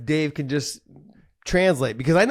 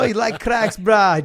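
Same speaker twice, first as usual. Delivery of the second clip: higher, punchy Pidgin challenge; Hawaiian Pidgin; 5 semitones higher, 5 dB louder, slower than usual. Retold or imitated. Fighter imitates sparring partner's challenge, Hawaiian Pidgin. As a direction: imitate a Hawaiian local goading you, louder on "brah". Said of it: "it was in Pidgin".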